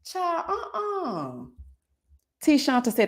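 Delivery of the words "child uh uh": whining. Real, not acted. Groaning about stomach.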